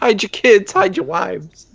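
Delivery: high, loud hammed-up warning; meme quote. Cohost welcoming the guest, hammed-up high meme warning. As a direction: belt the old meme as a mock alarm.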